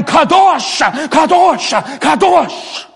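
very high, ringing cry; crying out in worship. Acted. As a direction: call out each kadosh as fervent praise. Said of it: "and they're crying".

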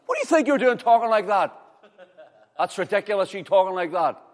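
high, loud shouted reprimand; indignant scolding. Retold or imitated. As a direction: tell them off at the top of your voice. Re-enacts scolding the child and parents.

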